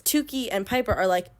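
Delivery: ordinary talk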